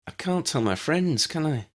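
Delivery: lightly voiced girl, pleading; Lydia's voice.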